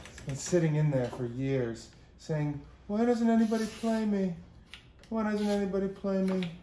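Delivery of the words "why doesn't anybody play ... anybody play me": high whiny plea from neglected gear; lightly voiced sad instrument begging; pleading gear voice. Neglected instrument begging to be played, whiny plea.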